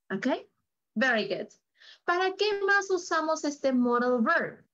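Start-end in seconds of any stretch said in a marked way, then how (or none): none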